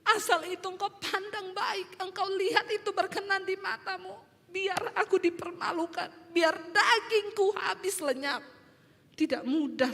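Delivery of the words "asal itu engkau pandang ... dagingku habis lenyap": high-pitched weeping prayer; re-enacted tearful pleading, growing firmer; retold tearful surrender. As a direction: give in to God as if in tears. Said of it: "Saya bercucuran air mata ngomong".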